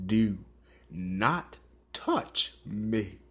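slow, quiet, deadly warning; low menacing threat. Retold or imitated. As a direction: spell out each word as a threat. Menacing threat whispered in ear.

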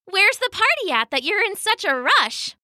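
high-pitched, curious otter; friendly otter. Acted. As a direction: ask like a friendly little otter. Otter greets the travellers.

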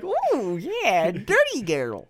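high, hammed-up seductive coo; mock sultry voice. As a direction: play it sleazy and over the top. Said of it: "I'm like".

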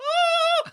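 shrieky, very high laugh; high squealed laugh. Real, not acted. Co-host cracking up at the bullfrog joke, squealed laugh.

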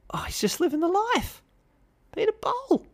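high mock voice. Host gushing about Peter Bol's private jet, in falsetto. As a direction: gush in a silly high voice.

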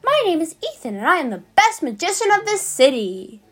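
hammed-up high showman voice; high magician voice. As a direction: play a grand stage magician. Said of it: "making the voice so high pitched".

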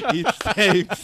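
laughing through the words; cracking up mid-sentence. Real, not acted. Laughing at own joke.

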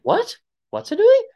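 very high, puzzled squeak; baffled, pitch shooting up; confused question. Retold or imitated. Family baffled by the cousin's made-up word, very high confused squeak. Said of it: "And we're like".

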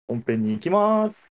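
slow, grand announcement; announcer voice. Acted. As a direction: announce it slowly and proudly. Announcing the main segment.

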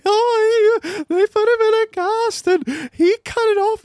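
high, slow mock mum voice; imitating mum. Mum telling the doctor he cut the cast off, high. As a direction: squeak it out like his flustered mum.

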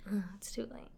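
breathy whispered lament. Real, not acted. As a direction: whisper it in despair. Whispered aside.

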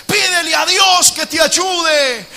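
high, loud and slowed, building to Peter's cry; preacher building to a desperate shout; shouted preaching. Acted. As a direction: cry out like a drowning man. Preaching at full force.

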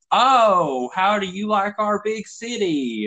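high, drawn-out mock cooing; condescending locals. Californians to a visitor from Tennessee, condescending imitation. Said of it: "They're like".

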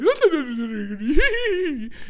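high, loud babble rising into a squeal; hammed-up goofy storytime noise; animated silly noise. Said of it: "I'm over there being animated".

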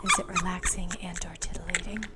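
soft playful whisper; ASMR whisper. Acted. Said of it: "A S M R".

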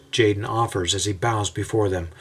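ordinary delivery

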